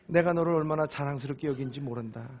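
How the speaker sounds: ordinary talk, nothing marked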